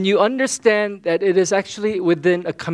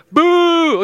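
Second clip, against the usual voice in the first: loud, high mock boo; playful booing; 11 semitones higher, 7 dB louder than usual. Laker fan pastor jeering the Boston Celtics, loud playful booing. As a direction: jeer the rival team with one loud boo.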